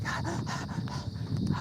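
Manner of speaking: big airy gasp for air; breathless gasp. Real, not acted. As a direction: gasp like you're winded. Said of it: "I'm like".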